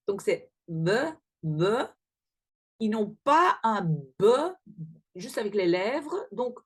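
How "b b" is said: B said with teeth on the lower lip; B, teeth touching lower lip. Shown as a demonstration. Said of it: "avec les dents sur la lèvre inférieure".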